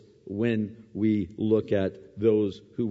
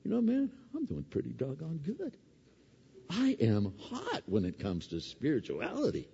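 smug self-praise; 8 semitones higher than usual. Pretend. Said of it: "come out going".

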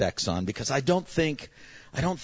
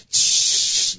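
long, loud hissed spray; imitated freeze spray; 8 dB louder than usual. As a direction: hiss like a nitrogen gun. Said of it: "he's going".

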